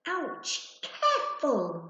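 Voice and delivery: sharp high yelp of "Ouch", then a slow, loud "Careful"; startled exclaim. A narrator's reading. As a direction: cry out as you bounce off his back. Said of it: "Wysiwyg exclaimed".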